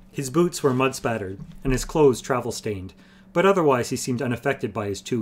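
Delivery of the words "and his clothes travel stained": plain reading voice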